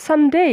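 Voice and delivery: "সানডে" rising onto a full "DEY" glide; correct pronunciation. Shown as a demonstration. Teacher modelling for learners to repeat, careful correct pronunciation. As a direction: lift and lean on the last syllable of "সানডে", san-DEY. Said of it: "Repeat after me".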